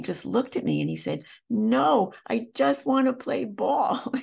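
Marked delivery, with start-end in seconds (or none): 1.5-4.1 lightly voiced small boy, high and slow; a child answering simply, high-pitched; little boy voice. Therapist voicing the little boy's reply, high and slow.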